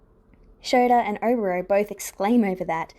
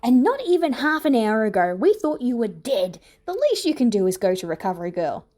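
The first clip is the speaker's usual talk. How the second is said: louder, scolding snap; snapping; 2 semitones higher, 3 dB louder than usual. A narrator's reading. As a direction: scold him sharply. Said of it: "snapping immediately".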